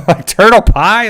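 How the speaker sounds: high, laughing, disbelieving; scoffing at the dish name through a laugh; laughing disbelief. Real, not acted. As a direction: laugh it out, incredulous. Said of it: "turtle pie just sounds terrible".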